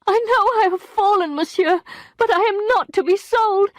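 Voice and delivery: high, loud, indignant outcry; crying out in outrage. A narrator's reading. Emma repelling the lawyer, crying out in outrage. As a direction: fling the refusal at him.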